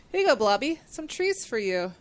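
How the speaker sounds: normal talking voice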